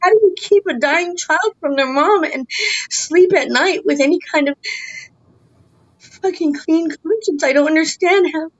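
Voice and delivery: high-pitched, upset disbelief; anguished outrage. Real, not acted. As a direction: demand an answer in real anguish. Mother condemns those keeping her child.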